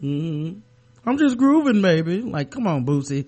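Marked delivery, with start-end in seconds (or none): 1.1-2.2 much higher and louder cool funk-star voice. Pretend. Co-host tells him to stop, he denies in a slick put-on voice. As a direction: play innocent in a slick funky croon. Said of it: "I know you don't talk like that".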